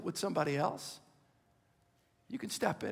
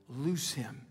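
fading to a whisper; 8 semitones lower, 8 dB quieter than usual. Acted. Quoting Jesus, fading to whisper.

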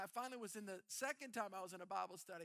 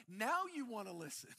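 teasing, a little louder; playful I-told-you-so; 5 dB louder than usual. Pretend. God teasing the people who finally turn, playfully smug. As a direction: toy with them now they finally turn. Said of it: "almost like I wanna play a game".

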